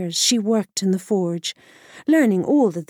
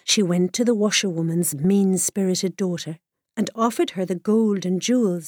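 ordinary delivery

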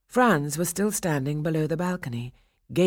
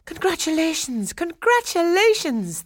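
joyful cry; 14 semitones higher, 5 dB louder than usual. A narrator's reading. Friends congratulating the couple, crying out excitedly. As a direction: cheer it twice, bigger the second time. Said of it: "they cried".

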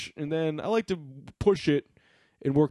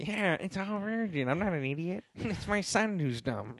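mock old boss voice; 2 semitones higher, 6 dB quieter than usual. Imitating Todd softly.

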